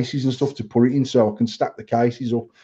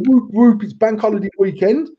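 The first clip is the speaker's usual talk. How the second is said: mock excited cheer; 7 semitones higher, 3 dB louder than usual. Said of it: "Every time people go".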